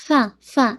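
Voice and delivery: short puff of air on فا; demo of letter sound. Shown as a demonstration. Teacher demonstrating air release to student. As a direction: let the air out on فا. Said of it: "ایسے sound آئے گی".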